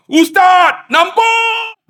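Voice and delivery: very high, drawn-out excited cry; hammed-up cry of amazement; amazed shout. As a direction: shout out a sudden wondrous sighting. Acts man suddenly seeing Kaabah.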